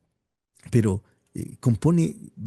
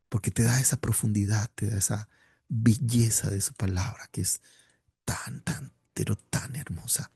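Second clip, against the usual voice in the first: much softer, unvoiced and hushed; awed whisper; 5 dB quieter than usual. Real, not acted. Preacher whispers in awe about the beauty of Scripture. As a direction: breathe it out in wonder.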